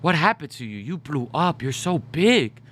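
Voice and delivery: hammed-up shocked relative; Samoan auntie. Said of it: "my Samoan auntie, was like".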